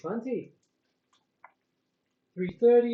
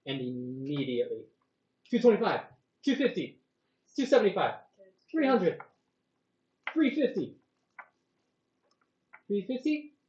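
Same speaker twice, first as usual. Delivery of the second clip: auctioneer; 3 semitones higher than usual. Acted. DM runs the auction.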